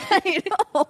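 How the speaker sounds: laughing while talking; giggling through it. Real, not acted. Laughs at super tree point.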